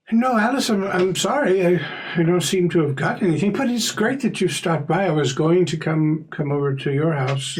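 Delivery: high-pitched apologetic neighbor; Mister Corbett. Acted. Neighbor telling Alice he has no letter, high-pitched and apologetic. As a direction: raise the pitch, kindly and apologetic.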